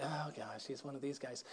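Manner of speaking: low, very quiet inward groan; dismayed aside. Retold or imitated. Newcomer privately dreading the zealous stranger, dismayed aside. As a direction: mutter it low to yourself, mock dismay.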